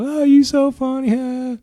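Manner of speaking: mock high teasing voice; teasing wife. Comic imitating his laughing wife, mocking high pitch. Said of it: "She's cracking up".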